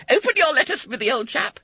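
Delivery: high-pitched, eager Bunter-like schoolboy; eager urging. Acted. Fat schoolboy to his chum about the letter, eager. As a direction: urge him on in a high, loud voice.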